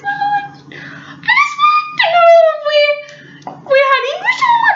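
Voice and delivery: a scared friend stammering her question; terrified squeak. Retold or imitated. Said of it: "slight terror in her voice".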